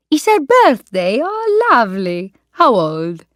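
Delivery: put-on a playful Italian accent, high and friendly; Italian waiter. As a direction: greet the old lady like a warm Italian waiter.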